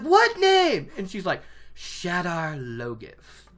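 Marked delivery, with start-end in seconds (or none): 0.0-0.9 very high, loud yelped question; hammed-up high-pitched insistence; insistent demand. Matt demanding again, more insistently. 1.7-3.5 slow, drawn-out ominous reveal; grave, lingering announcement. Acted. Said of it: "very ominously".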